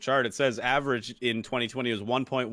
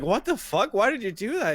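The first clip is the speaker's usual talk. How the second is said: a higher, incredulous question; mock disbelief; 9 semitones higher than usual. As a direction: react like you can't believe it. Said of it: "they were like".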